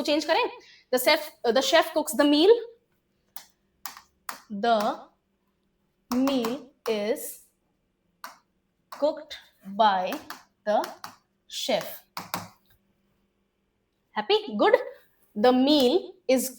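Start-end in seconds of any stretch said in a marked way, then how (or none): 4.6-11.8 slow dictation. Shown as a demonstration. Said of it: "dictating word by word".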